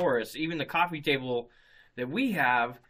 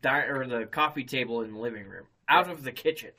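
usual speaking voice

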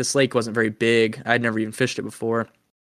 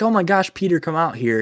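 higher-pitched imitation of excited calling; 6 semitones higher than usual. Wife calls her husband out to talk baits, imitated calling out. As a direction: mimic a wife summoning someone, pitch lifted.

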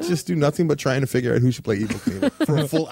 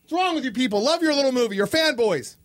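real frustrated yelling, pitched way up; furious outburst; 11 semitones higher, slower than usual. Real, not acted. Cohost loses the bet, shouting in real frustration. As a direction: explode at losing the bet.

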